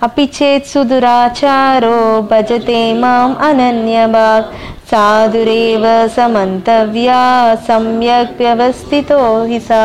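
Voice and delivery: slow chanted Sanskrit verse; verse recitation. Acted. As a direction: intone the verse slowly.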